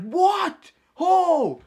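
very high yell of disbelief; freaking out. Retold or imitated. Kids watch him do a cut, freaking out. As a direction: imitate kids losing their minds. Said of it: "they all freak out".

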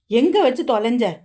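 flustered question. A narrator's reading. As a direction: ask in a flustered rush. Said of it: "படபடப்பாக கேட்டாள்". (பதற்றத்துடன் கேள்வி)

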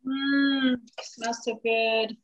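ordinary delivery

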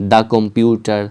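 the computer, "the" said "daa". Shown as a demonstration. Teacher recapping examples for learners, "daa computer". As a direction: open "the" to "daa" before computer. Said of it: "এটার ক্ষেত্রে কী ছিল?".

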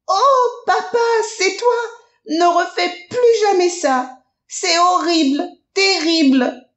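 put-on high, breathless child voice pleading; a scared child begging, voice high; shaken little rabbit. Lapin soulagé et effrayé. (petit lapin encore effrayé, voix aiguë)

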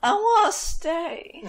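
high, slow whiny plea. Acted. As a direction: whine like a spoiled giant. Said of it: "in a whiny, whiny voice".

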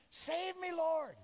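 high, loud cry for help; mock shout. Peter shouting to Jesus while sinking, mock loud plea. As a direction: call out like a sinking man. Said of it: "he shouted".

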